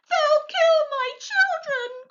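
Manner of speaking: high, slow tearful wail; crying out. A narrator's reading. Mrs Fox fearing for her children, high tearful cry. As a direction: wail it in terror for her cubs. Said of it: "cried Missus Fox".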